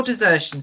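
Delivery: mild particular emphasis on "desertion"; stress on DESERTION. A narrator's reading. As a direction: lean on the word "desertion". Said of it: "laying a particular emphasis on the word desertion".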